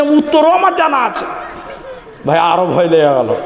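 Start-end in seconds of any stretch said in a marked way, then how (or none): 0.0-1.3 smug boast. Retold or imitated. Boy boasts knowing the answer.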